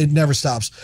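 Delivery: usual speaking voice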